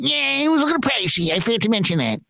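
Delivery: high put-on voice, dropping at the end; sheepish-excuse impression of the high-voiced colleague; Ralph. Colleague admitting the price, high impersonated voice. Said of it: "He's like".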